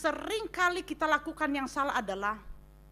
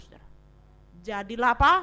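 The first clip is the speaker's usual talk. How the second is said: ordinary talk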